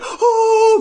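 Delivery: tiny creature squeak of wonder; 尖细惊叹的"哦"; high squeaky "oh". Retold or imitated. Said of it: "come out like".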